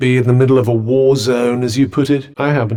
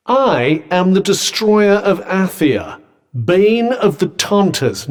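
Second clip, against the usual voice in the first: slow, higher, ringing proclamation; grandiose boast; 5 semitones higher, slower than usual. Acted. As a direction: proclaim your titles with slow, lofty menace. Boasting of own fearsome titles.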